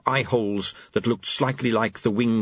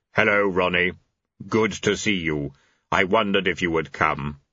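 deep solemn voice; 4 semitones lower than usual. A narrator's reading. Death greeting Chaos.

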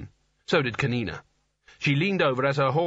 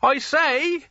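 high, louder polite call; shouting; 13 semitones higher, 4 dB louder than usual. A narrator's reading. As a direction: call up to the giant. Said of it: "he shouted".

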